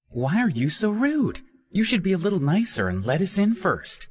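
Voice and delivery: put-on high, indignant young woman scolding; bossy girl talking down to him; high-pitched reproachful young woman; Minnie's voice. A narrator's reading.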